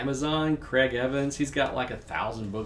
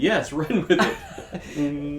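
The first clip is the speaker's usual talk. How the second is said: laughing; 5 semitones higher, 7 dB louder, slower than usual. Real, not acted. Laughing, urging co-host.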